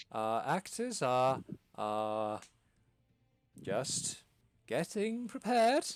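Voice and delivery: lightly voiced flustered impresario; flustered hostess. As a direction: gush nervously while covering for the troupe. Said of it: "Quacosa composes herself and goes".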